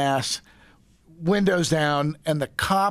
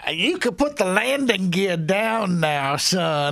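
drawling Southern Arizona lawman; Arizona cop accent; 3 semitones higher, slower than usual. Retold or imitated. Cop to pulled-over speeder, Arizona accent. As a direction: put on a desert Arizona cop's accent. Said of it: "does this accent right now".

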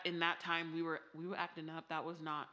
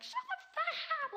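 put-on screeching toddler; much faster than usual. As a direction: shriek it like a tiny furious kid. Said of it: "He's like".

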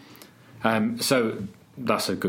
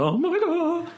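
surprised; slower than usual. Real, not acted. Exclaims at the joke.

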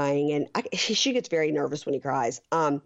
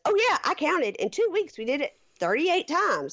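much higher, breathy-free girlish imitation of Angela; high mock Angela; 10 semitones higher than usual. Host imitating Angela bragging about counting, high mock voice. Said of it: "Angela says".

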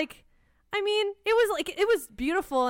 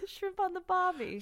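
laughing mock Australian accent; a grudging Aussie line through laughter; reluctant redo of the Aussie accent; 6 semitones higher, 6 dB quieter than usual. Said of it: "your Australian accent again?".

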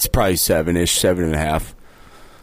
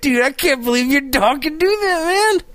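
mock amazement; 12 semitones higher than usual. Mock amazement at talking dog.

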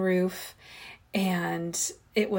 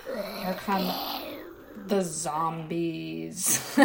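slow, dragged-out zombie moan; 3 dB quieter, much slower than usual. Pretend. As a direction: drawl it like a shambling zombie. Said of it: "that's my little zombie sound".

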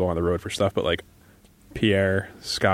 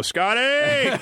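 high excited call of a name. Retold or imitated. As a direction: call the name out, much higher. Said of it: "You just hear Pierre go".

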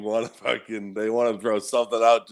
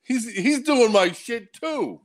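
mock Russell; 9 semitones higher, slower than usual. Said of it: "Russell's like".

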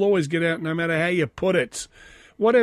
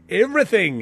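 "everything" read out a bit louder and higher; reading in caps; 5 semitones higher, 5 dB louder than usual. Retold or imitated. Reading a comment's shouted caps word, mild emphasis. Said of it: "in caps".

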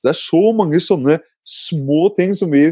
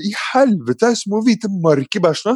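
retold shock; 8 semitones higher than usual. Father discovers worms, retold shock.

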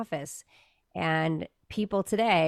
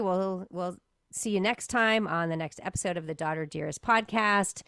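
ordinary delivery

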